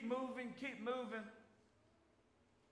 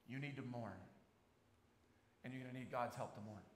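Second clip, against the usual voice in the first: usual speaking voice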